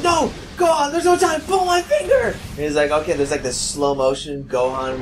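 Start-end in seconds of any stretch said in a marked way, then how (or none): 0.0-2.3 high shouted cartoon panic; very high hammed-up hero shout; frantic Goku. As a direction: scream it like a desperate anime hero.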